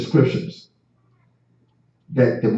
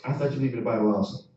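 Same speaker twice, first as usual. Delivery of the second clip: much softer, fast, almost to himself; quiet repeat; 3 semitones lower, 10 dB quieter, much faster than usual. Acted. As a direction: drop it under your breath. Repeats the line twice.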